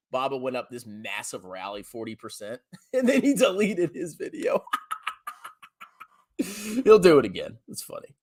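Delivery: usual speaking voice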